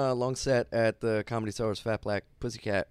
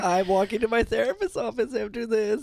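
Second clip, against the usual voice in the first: shaky, nervous voice; adrenaline shakes; 7 semitones higher than usual. Real, not acted. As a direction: speak while trembling with nerves. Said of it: "I can't stop shaking".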